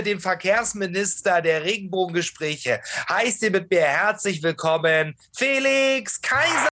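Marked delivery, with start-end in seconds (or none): 5.3-6.7 drawn-out high boxing ring call; ring announcer. Acted. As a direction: stretch the name like a fight announcer. Introducing guest like ring announcer.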